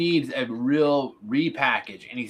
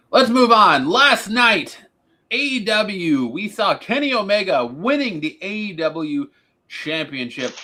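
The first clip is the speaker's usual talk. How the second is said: raised, louder announcer-style delivery; show host segue; 6 semitones higher, 6 dB louder than usual. Real, not acted. Host moving the panel on to last night's title win, announcer-style. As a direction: punch up the headline like a show host. Host moves to next topic.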